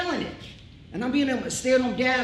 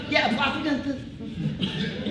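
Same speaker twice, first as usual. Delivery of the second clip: laughing friend imitation; 5 semitones higher, much slower than usual. Imitating friend's phone talk.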